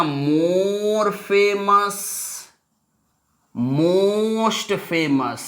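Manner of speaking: very slow, stretched "मोर" and "most"; drawn-out demonstration. Shown as a demonstration. Teacher giving class the answer forms, very slow and stretched. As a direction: hold the vowel long in "मोर" and "most".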